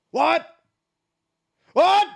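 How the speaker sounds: high, loud shocked yelp of what; mock shocked. Viewer pretending to be shocked by a repeated dance move, loud high yelp. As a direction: play the stunned onlooker, loud. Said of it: "act shocked every time".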